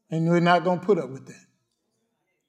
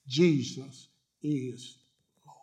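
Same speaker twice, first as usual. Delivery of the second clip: slow, breathless whisper; barely voiced awe; 5 semitones lower, 7 dB quieter, much slower than usual. Real, not acted. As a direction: hush it to a reverent whisper. Hushed confession of faith.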